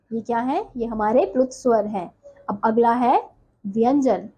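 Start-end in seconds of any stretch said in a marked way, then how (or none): none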